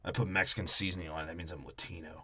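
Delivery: low mocking voice. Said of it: "mocking Vin Diesel".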